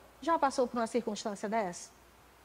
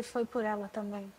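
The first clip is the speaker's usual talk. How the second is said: soft, hushed and tender; God's gentle voice; 3 semitones lower, 9 dB quieter than usual. Retold or imitated. God speaking to her heart in the nursery, soft and tender. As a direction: speak it as a gentle rebuke of love. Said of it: "E ele disse".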